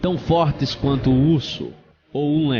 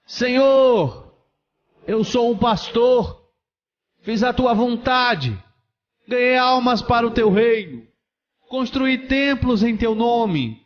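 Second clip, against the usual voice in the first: desperate shouting; 10 semitones higher than usual. Acted. Condemned pastor pleads before God's throne, shouting. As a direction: cry out, pleading your case. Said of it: "ele começou a gritar".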